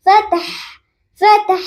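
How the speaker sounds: فتح with fa, ta and ha joined. Shown as a demonstration. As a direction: blend فتح smoothly to the ha. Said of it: "آپ کی آواز ایسے آنی چاہیے". (فتح میں فا کو تا اور ہا سے ملائیں)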